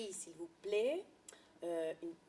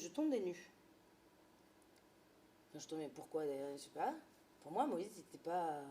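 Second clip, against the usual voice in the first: ordinary talk, nothing marked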